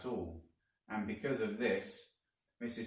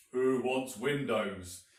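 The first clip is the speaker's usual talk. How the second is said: slow, louder sneering grump; grumpy Mr Twit; 3 semitones higher, 6 dB louder, slower than usual. A narrator's reading. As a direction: scoff at the very idea. Mr Twit grumbling, quoted.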